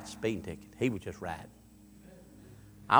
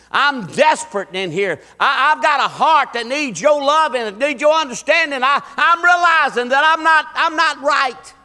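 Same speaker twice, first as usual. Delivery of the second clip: loud, high preacher's cry to God; acted desperate plea; 13 dB louder than usual. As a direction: plead at full force as a desperate sinner. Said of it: "you're a desperate person".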